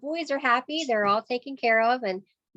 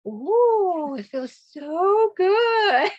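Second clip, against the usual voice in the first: high, stretched-out, louder ooh of pleasure; delighted kids; 9 semitones higher, 6 dB louder, much slower than usual. Retold or imitated. Boys react after washing hands.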